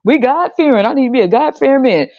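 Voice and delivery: high, prissy mock voice; a woman mocked for wanting a God-fearing man, high and prissy. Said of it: "Making them stupid ass faces".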